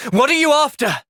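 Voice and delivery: high, sharp demand; confrontation. Acted. Hero confronts a suspicious stranger, demanding. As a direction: challenge them hard.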